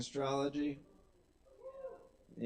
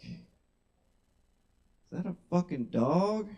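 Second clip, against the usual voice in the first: mock horror; 3 semitones higher, 3 dB louder, much slower than usual. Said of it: "I'd be like".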